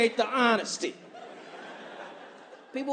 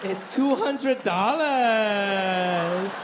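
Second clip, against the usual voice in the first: proud mom; much slower than usual. Retold or imitated. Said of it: "And she was like".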